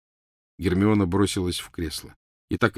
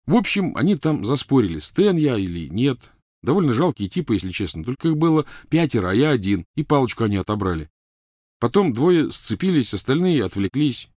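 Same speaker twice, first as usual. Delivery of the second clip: put-on higher, animated young man's account; lively explaining, pitched up from narration; Ron, eager storyteller; 5 semitones higher, 4 dB louder than usual. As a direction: recount the misadventure energetically. Ron tells his story.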